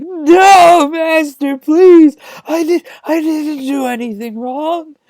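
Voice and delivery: put-on very high, bawling baby whine; mock crying. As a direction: wail like a little baby, hammed up. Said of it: "starts crying like a freaking little baby".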